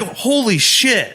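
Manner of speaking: slow amazed gasp of words. Retold or imitated. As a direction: sound stunned and delighted. Voicing amazed skater.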